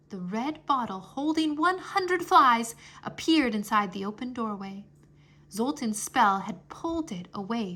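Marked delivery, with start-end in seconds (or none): none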